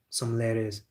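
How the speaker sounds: ordinary delivery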